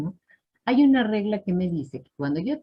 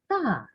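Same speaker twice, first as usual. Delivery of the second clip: "ta" high then down, unforced; soft falling tone; 5 semitones higher than usual. Shown as a demonstration. Teacher shows that is all it takes, soft falling tone. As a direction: drop "ta" gently from a high start. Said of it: "es suavecito".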